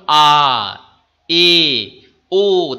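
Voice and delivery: আ, এ, ও held long and loud; long vowels. Shown as a demonstration. Teacher showing students long vowels, held out loud. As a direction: stretch each vowel আ, এ, ও with full breath. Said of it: "বেশি শ্বাসবায়ু লাগে তখন দীর্ঘস্বর".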